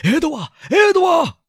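very high, loud calling of a name; frantic, worried yell; anxious shout. A narrator's reading. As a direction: scream for your missing child. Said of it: "他冲出房间喊道".

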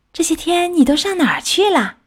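high-pitched, eager question; excited. A narrator's reading. Fish greeting its returning frog friend, excited. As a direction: ask eagerly, pitched well up. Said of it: "鱼激动地问他".

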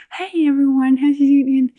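hammed-up fake YouTuber hello; fake bright host greeting. Mocking her nervous first-episode self with a fake greeting. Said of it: "trying to be like".

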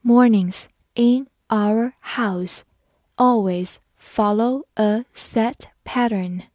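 Mornings in our house read slowly, word by word. Shown as a demonstration. Teacher reading slowly so students repeat after her. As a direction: read it slowly for learners to follow. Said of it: "好，慢慢来读".

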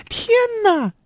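very high, startled cry; scared storybook wife; frightened exclamation. A narrator's reading. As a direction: gasp it out in a high woman's voice. Wife sees the huge dog, frightened.

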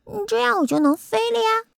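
high-pitched little creature voice; eager little bumblebee. Acted. Bumblebee explains to the doctor, high and eager.

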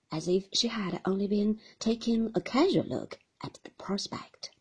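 ordinary delivery, nothing marked

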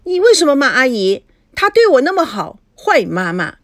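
put-on high child's indignant voice; righteous protest. A narrator's reading. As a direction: stand up to mom, child-like and indignant. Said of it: "一脸正义的样子". (孩子理直气壮地质问)

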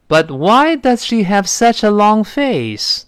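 teacher reading in a put-on voice; slow model sentence. Shown as a demonstration. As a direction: read it slowly, dropping at the close. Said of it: "在这里这个but在这儿呢".

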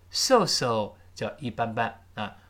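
ordinary talk

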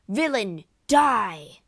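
loud, explosive attacker's shout; shouted threat. A narrator's reading. Rodrigo attacks with a dagger, shouting. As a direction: shout it, hitting the last word hardest. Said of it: "shouted Rodrigo".

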